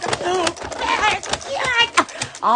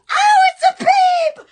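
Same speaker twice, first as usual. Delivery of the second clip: high, loud gushing "Oh, it's a babe"; squealing at a cute bunny; delighted squeal; 13 semitones higher, 5 dB louder than usual. Real, not acted. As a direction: gush over something cute.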